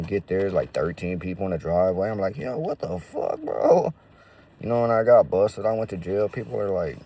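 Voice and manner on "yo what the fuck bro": rising to a loud high yelp on bro; shocked outburst. Retold or imitated. Seeing a crowd in his driveway, loud high shocked yelp. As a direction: act floored by thirteen people in the driveway.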